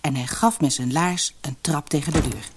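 ordinary delivery

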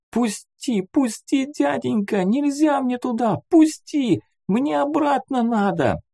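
put-on very high, pleading child's voice; strained whisper-cry. A narrator's reading. Struggling boy begs the man to let him go, crying out desperately. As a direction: beg to be let go as a panicked little boy. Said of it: "пацан вырывался и шёпотом кричал, периодически срываясь на голос".

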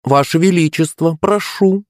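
high, mock-courteous girlish purr. A narrator's reading. Girl inviting her rival to the bar, purring. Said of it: "промурлыкала она".

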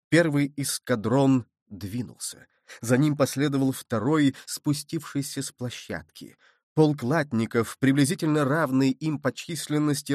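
ordinary delivery, nothing marked

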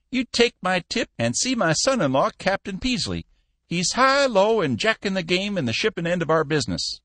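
put-on an old boss kindly waving him off; lightly voiced old man; old Cappy voice. Cappy giving a tip.

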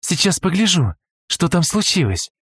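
put-on high, brisk girl's voice; a quick, light female character; young woman voice. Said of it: "заявила Юси".

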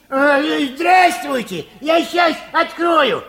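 loud, high call toward the door. Acted. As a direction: shout a welcome to someone outside. Calling to visitor through door.

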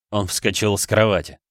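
ordinary delivery, nothing marked

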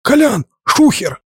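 high piercing shriek of alarm; shrill yell. A narrator's reading. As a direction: scream the warning at the top. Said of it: "от визгливого вопля".

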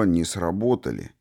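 ordinary delivery, nothing marked

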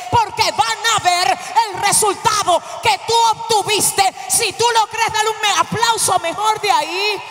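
full-voiced, insistent preaching; fervent preaching. Real, not acted. Preacher tells congregation the devil will not embitter them, fervent. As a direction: drive each phrase out to the crowd.

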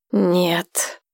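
ordinary delivery

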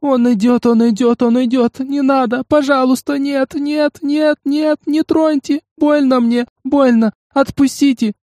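high, pleading, panicked wails; crying scream. Acted. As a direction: scream and sob in terror. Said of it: "надрывный, плачущий крик".

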